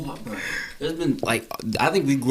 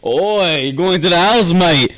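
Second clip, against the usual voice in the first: higher, louder British call, oi mate; hammed-up Cockney hail; mock British oi; 7 semitones higher, 4 dB louder, slower than usual. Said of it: "continues British bit".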